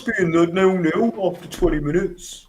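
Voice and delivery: put-on Birmingham accent, high and nasal; Brummie accent. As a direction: say it like a Brummie fan. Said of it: "how good my Brummie accent is".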